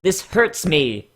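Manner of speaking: ordinary talk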